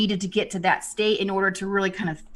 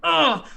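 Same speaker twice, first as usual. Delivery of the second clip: frustrated groan; 8 semitones higher, 6 dB louder than usual. Retold or imitated. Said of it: "he goes".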